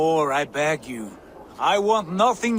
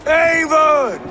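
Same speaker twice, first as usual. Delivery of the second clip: loud, high, glad call of a name; joyful greeting call; 9 semitones higher, 6 dB louder than usual. Acted. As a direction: hail a sibling after long absence. Sigurd greets sibling on arrival.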